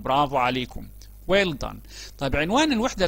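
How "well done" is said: ordinary delivery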